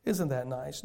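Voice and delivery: low, soft and sarcastic "Oh, isn't that nice?"; sarcastic mockery. Preacher to congregation, mocking the bland ad, low soft sarcasm.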